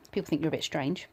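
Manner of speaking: usual speaking voice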